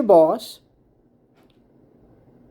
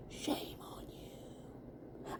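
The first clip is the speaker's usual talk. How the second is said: whispered rebuke; 26 dB quieter, much slower than usual. Acted. Final hushed rebuke.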